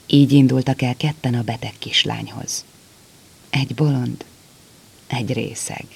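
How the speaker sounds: usual speaking voice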